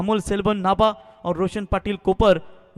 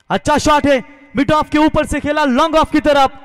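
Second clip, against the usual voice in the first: loud, high, thrilled call of a six; excited cricket commentary; 6 semitones higher, 6 dB louder than usual. Real, not acted. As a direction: shout the six as it sails over. Six hit, commentator erupts.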